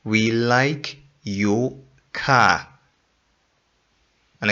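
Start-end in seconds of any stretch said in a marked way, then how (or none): 0.0-2.7 "We like your car" said slowly and deliberately; teacher models the correct sentence, slowly; slow correct form. Shown as a demonstration. Teacher models the right form for students, slowly and deliberately.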